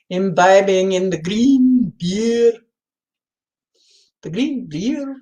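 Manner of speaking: put-on "green beer" in a clumsy, slow Irish accent; a first, poor stab at an Irish lilt on "green beer"; slowly drawn-out mock-Irish "in the green beer"; bad Irish accent. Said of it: "That's a horrible, no, that isn't a, act, the right accent".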